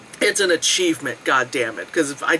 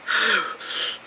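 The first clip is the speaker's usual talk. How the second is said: breathy sigh; 5 dB quieter than usual. Real, not acted. Resigned sigh to Paul.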